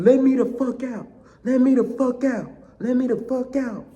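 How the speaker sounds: high, loud reenacted yell; retold desperate shout. Prisoner demands release from solitary, shouting.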